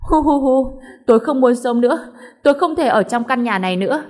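mother wailing on the floor again. Acted. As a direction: wail as if the world ends. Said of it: "gào khóc".

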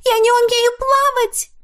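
very high, loud panicked shriek; terrified scream. A narrator's reading. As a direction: scream as you drown. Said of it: "кричала Паула".

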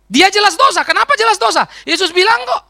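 loud, high, insistent preaching; preaching at full force. Real, not acted. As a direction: drive the point home to the pews.

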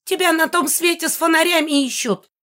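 very high indignant yell; high screeching rage; angry yelling. A narrator's reading. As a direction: holler it as a furious old ghost. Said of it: "орала Мегара".

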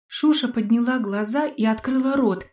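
plain reading voice

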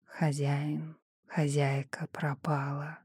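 slow whispered voice in the head; rustling whisper. A narrator's reading. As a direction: breathe it like a rustle. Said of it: "в голове прошелестел голос Эдварда".

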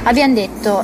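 normal talking voice

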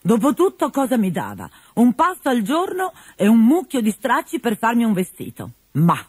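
put-on high, chirpy young servant; cheerful Arlecchino. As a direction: Arlecchino talking himself into good cheer.

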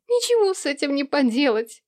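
high, tearful wail; through sobs. A narrator's reading. Daughter beating the door frame, through sobs. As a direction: repeat it helplessly through tears. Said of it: "приговаривала она сквозь рыдания".

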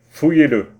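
loud, sharp command; curt order. Acted. Mayor ordering gendarmes to search him, loud command.

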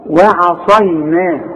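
وعصينا slowly, light wa-'a then dark saad; light waw, heavy saad. Shown as a demonstration. Teacher correcting the student, careful slow وعصينا. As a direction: keep the start of وعصينا thin and darken the ص. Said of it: "الصاد مفخمة".

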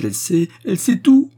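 high, loud distressed complaint; tearful outburst. A narrator's reading. Widow complaining about the maid, tearful outburst. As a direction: let the grievance burst out. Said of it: "Déluge de larmes".

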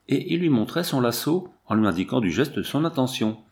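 usual speaking voice

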